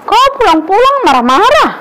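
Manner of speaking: loud, high scolding; wife yelling at her husband; angry wife. Acted. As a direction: snap back at the husband at full volume.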